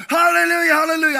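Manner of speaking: high, loud rapturous cry; ecstatic hallelujah. Retold or imitated. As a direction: shout it out in rapture. Girl filled with the Spirit.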